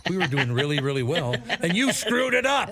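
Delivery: retold gripe climbing into a comic outburst; mock accusation. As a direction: play-act blaming someone, pitch soaring at the end.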